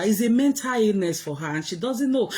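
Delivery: normal talking voice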